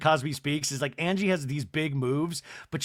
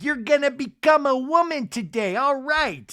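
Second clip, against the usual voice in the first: mock high Seth voice; 9 semitones higher, 4 dB louder than usual.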